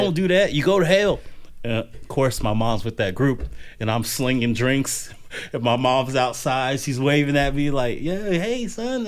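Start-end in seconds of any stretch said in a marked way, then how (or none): 0.0-1.2 higher, louder preaching warning; street preacher. Retold or imitated. Preacher warning kids outside the bar, loud and high. 8.0-9.1 higher, sing-song hello; mom's sweet greeting. Retold or imitated. Religious mom greeting her bartender son at midnight, sweet and high.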